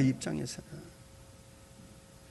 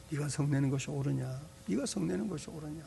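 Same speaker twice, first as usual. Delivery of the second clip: God's gentle question; 3 semitones lower, 11 dB quieter, faster than usual. Retold or imitated. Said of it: "요나에게 질문하시는 거예요".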